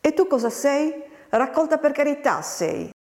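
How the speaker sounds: high-pitched taunting comeback; quarrelsome retort. A narrator's reading. Farmhand answers the girl's insult, quarrelsome retort.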